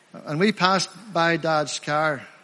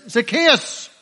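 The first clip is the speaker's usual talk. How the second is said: higher-pitched call by name; 4 semitones higher, 4 dB louder than usual. Acted. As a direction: call the name out to someone in a crowd. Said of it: "the Lord Jesus shouted out".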